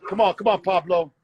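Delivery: loud, high call to the dog. Real, not acted. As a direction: call Pablo across the yard. Calling the dog to come in.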